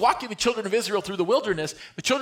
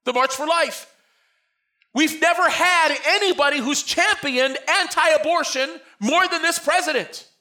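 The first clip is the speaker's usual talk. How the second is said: loud, high-pitched preacher's cry; preaching at full force; 10 semitones higher, 7 dB louder than usual. Acted. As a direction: preach it out to the whole church.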